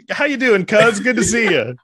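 high, mock-friendly hello; chummy greeting. Greeting a cousin after the sack dap, mock-chummy.

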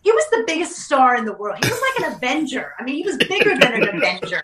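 high-pitched, insistent amazement; excitedly making the case, half laughing; emphatic retelling to kids. Retold or imitated. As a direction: impress it on the boys, pitch jumping up. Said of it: "I try and tell the boys now".

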